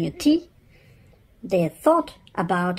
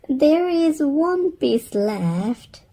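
put-on higher, slow helpful child; 8 semitones higher, 3 dB louder, slower than usual. Laura telling mum about the cake, sweet and helpful. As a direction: point it out sweetly. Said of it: "in a helpful way".

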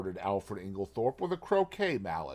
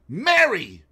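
Mary! flung out sharp, higher and louder; angry outburst; 8 semitones higher, 9 dB louder than usual. A narrator's reading. Husband rebuking his wife, sharp angry cry. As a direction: snap her name in outrage. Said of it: "The pleading died out of his voice".

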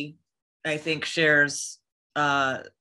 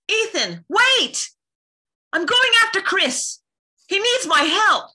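very high, loud desperate cry; high-pitched heroine in a panic; urgent calling; 6 dB louder than usual. Acted. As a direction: shout after him, pitch way up. Acting urgent game lines.

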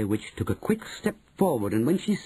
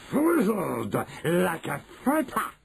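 high gleeful witch voice; 9 semitones higher, slower than usual. Acted. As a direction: relish it as the witch.

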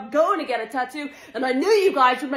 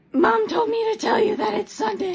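put-on kid voice; 7 semitones higher than usual. Kid relaying between mom and grandma.

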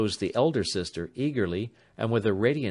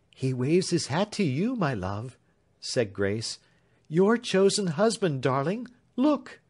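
gentle; 6 semitones higher than usual. A narrator's reading. Grace comforts Marian.

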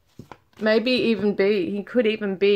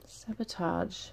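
murmured; 3 semitones lower, 15 dB quieter, slower than usual. Real, not acted. Reading card names to herself.

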